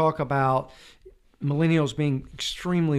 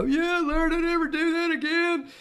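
put-on sulky young self; 14 semitones higher than usual. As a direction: whine it like a chastened kid. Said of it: "I go".

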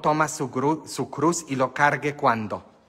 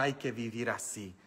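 normal talking voice

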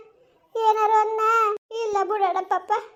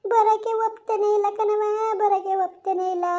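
sobbing lament. Acted. Grieving husband telling Kamli that they refuse to come, sobbing lament. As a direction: let the repeated complaint dissolve into sobs.

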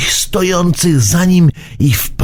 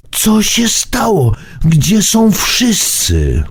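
put-on shaken boy; 9 semitones higher, slower than usual. A narrator's reading.

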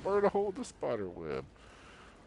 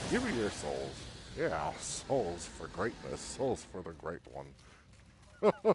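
mock villain; 7 semitones lower, 7 dB quieter, slower than usual. Villain-like soul demand.

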